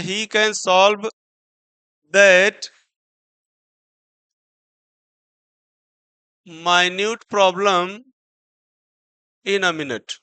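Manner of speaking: very slow, word by word, minute as my-nyoot; deliberate slow reading aloud; slow dictation. Shown as a demonstration. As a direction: spell the sentence out slowly for notes. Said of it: "इसको हम पढ़ेंगे minute".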